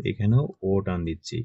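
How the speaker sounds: normal talking voice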